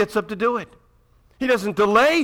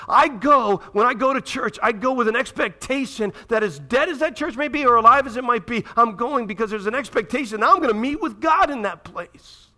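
impassioned preaching; 6 semitones higher, 4 dB louder than usual. Real, not acted. Preaching at full force.

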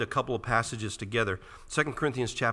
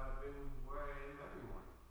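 softly voiced wife's answer, level pitch; quiet retold reply; 3 semitones higher, 13 dB quieter than usual. Wife answering her husband's question about where she has been, quiet retold reply.

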